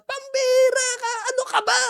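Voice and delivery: high exasperated yell; hammed-up shout at a baby believer; mock yell. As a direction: scold in disbelief, pitched high. Scolding baby, shown as wrong.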